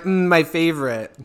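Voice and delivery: loud mock relish; imitating friend. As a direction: mimic her smug delight. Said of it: "you're like".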